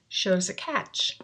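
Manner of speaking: ordinary talk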